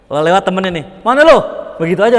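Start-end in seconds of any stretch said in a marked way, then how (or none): 1.0-1.4 higher, louder blunt shout; preacher imitating a Betawi man yelling to a friend, loud and blunt; rough Betawi call. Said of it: "Orangnya keras-keras".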